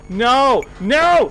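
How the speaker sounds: high, loud cry of "no"; real panicked yell; alarmed shout. Real, not acted. Panics at the game.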